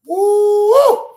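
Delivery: high loud whoop, letting off steam; loud woo. Real, not acted. Venting to viewers about the supervisor, a loud high woo. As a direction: let out a big high woo.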